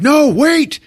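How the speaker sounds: high, loud mock cry to stop; hammed-up protest while being pulled up. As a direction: cry out to halt them. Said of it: "he's like".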